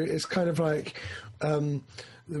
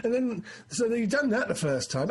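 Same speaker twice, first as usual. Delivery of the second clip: normal talking voice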